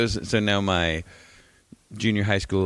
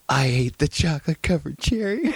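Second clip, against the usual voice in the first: higher voice, breaking into laughter; laughing confession; 7 semitones higher than usual. Retold or imitated. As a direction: confess it through rising giggles. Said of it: "he starts laughing and he goes".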